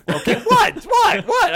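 loud, high repeated what, changing the subject; mock outrage. Cohost loudly pretending not to hear to dodge the topic. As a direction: blurt it out to drown the topic. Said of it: "I'm trying to change the subject".

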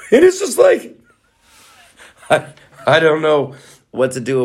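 high laughing exasperation. Real, not acted. Vlogger laughing helplessly about scammers using his face. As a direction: laugh through the frustration.

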